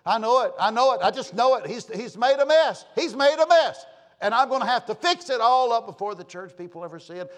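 loud, high-pitched worked-up fretting; exasperated rant. Retold or imitated. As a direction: rant in mounting exasperation, voice pushed up. Acts out his exasperated worried thoughts.

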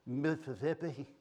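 slow, soft, drawn-out state name; comic put-on drawl; mock feeble answer. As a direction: stretch it out limply for laughs. Mocking another state name for laughs.

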